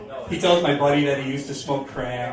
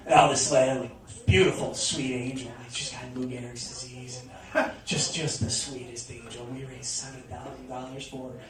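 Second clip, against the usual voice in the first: softly mocking self-righteous tribute, trailing lower; self-righteous spiel; 3 semitones lower, 6 dB quieter than usual. Club owner praising a sick lady, mock self-righteous. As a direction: imitate a hushed, sanctimonious tribute. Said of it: "goes in this big self-righteous spiel".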